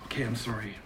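low, near-whispered sheepish apology; hushed apology. Real, not acted. Guest apologizing to host after being scolded, hushed. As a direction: drop right down to a whisper.

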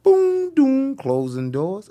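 drawn-out sing-song train chime; train door chime. Retold or imitated. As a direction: imitate the subway's two-note chime and slow announcement. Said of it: "when you listen to the sound, it sound".

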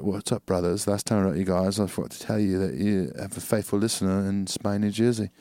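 smooth British accent, no stumbles. Shown as a demonstration. Showing co-host stutter-free reading, easy British accent. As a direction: read it softly and evenly in British English. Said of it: "just read like this".

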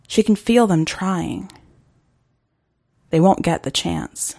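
usual speaking voice

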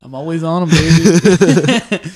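smug laid-back cool guy. Retold or imitated. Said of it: "He's like".

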